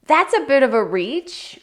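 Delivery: doubting reaction to the clip; skeptical. Real, not acted.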